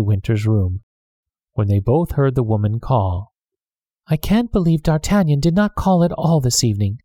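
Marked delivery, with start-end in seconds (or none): 4.1-7.0 lightly voiced fretful noblewoman; a hint of a vexed lady complaining; mild fretful lady's voice; fretting lady. A narrator's reading. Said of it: "Lady de Winter fretted".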